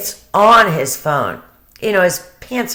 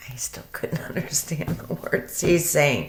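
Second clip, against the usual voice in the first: laughing whisper; 6 dB quieter than usual. Real, not acted. Laughing through the complaint.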